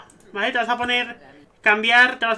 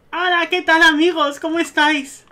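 high-pitched, effusive greeting; welcoming host; 5 semitones higher, 3 dB louder than usual. Pretend. Host greets arriving wedding guests, effusive welcome. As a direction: usher guests in with a high, eager voice.

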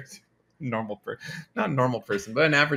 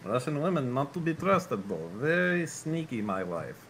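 Russian accent. Shown as a demonstration. Dan shows off his premium Russian accent to friends. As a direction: play a deadpan Russian with a heavy Russian accent. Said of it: "very most premium Russian accents".

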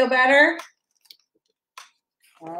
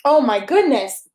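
usual speaking voice

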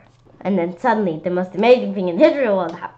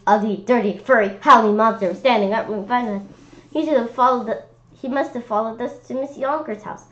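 plain reading voice

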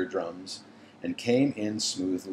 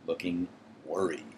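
ordinary delivery

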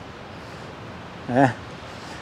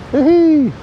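high, loud "woohoo"; whoop; 10 semitones higher, 5 dB louder than usual. Real, not acted. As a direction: let out an excited whoop. Whooping at the outdoors.

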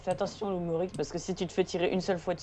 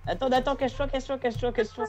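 urgent call; 7 semitones higher, faster than usual. Real, not acted. Urging teammate to hide.

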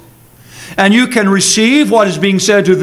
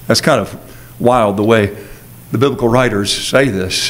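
ordinary talk, nothing marked

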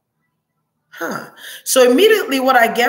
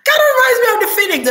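emotional burst; 4 dB louder, much faster than usual. Real, not acted. Emotional burst after a long pause.

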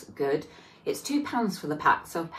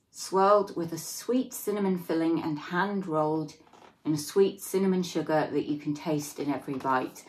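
usual speaking voice

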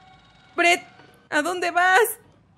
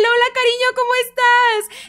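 higher, tender greeting; pet talk; 8 semitones higher, 3 dB louder than usual. Real, not acted. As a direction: coo at the pet.

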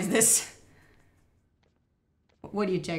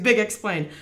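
ordinary talk

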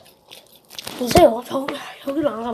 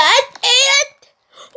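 long effort yell; 10 semitones higher, 5 dB louder, much slower than usual. Real, not acted. Forcing a jump.

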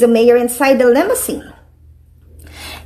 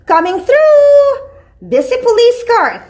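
busy police car; 9 semitones higher than usual. A narrator's reading.

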